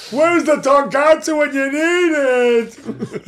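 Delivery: high, loud, odd cartoonish voice; cartoon monster. Playing the Grumblezilla toy as a loud cartoon character. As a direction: ham up a grumpy cartoon creature. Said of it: "[name] Godzilla persona".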